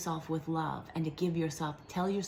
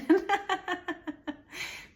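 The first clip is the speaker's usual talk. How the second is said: bright giggle, pitched way up; light self-amused giggle; high laugh; 12 semitones higher than usual. Real, not acted. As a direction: laugh at yourself about the pollen. Laughing off losing voice.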